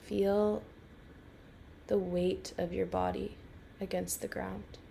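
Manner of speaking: calm, unhurried guiding voice with long pauses; guided meditation. Real, not acted. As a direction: lead a listener gently into rest, phrase by phrase.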